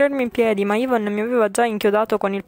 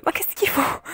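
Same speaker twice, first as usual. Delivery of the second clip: high, breathless ma che schifo with a breathy push on che; real disgust; 8 semitones higher than usual. Real, not acted. Streamer seeing the spat blood, high breathy disgust. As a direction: recoil in disgust.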